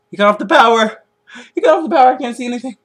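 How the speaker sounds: high, loud horror-movie alarm; acted panic. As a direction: play the victim panicking in the dark. Acting out a killer-in-house panic.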